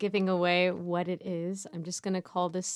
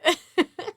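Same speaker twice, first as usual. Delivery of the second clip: high laugh; 10 semitones higher, 6 dB louder than usual. Real, not acted. Laugh after punchline.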